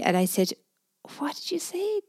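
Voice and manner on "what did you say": high, breathless whisper of disbelief; stunned disbelief. Retold or imitated. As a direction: gasp it out, unable to believe what you heard. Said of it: "looking at him wide-eyed and disbelievingly".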